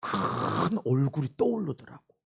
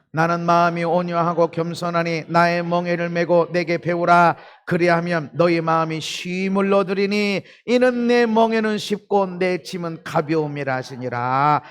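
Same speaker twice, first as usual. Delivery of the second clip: louder, solemn Bible recitation; scripture reading; 3 semitones higher, 9 dB louder than usual. Acted. Preacher reading the closing verse to the congregation, solemn. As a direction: read the verse to the church.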